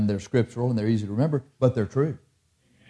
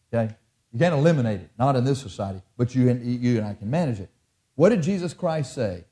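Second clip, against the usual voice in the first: ordinary talk, nothing marked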